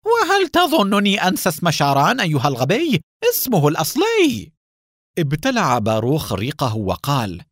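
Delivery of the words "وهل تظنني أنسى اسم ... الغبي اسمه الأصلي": high, loud furious yell; angry shout. Acted. Haim shouts at Baruch in a rage. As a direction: bark it at the fool, voice way up. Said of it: "صاح حاييم بغضب".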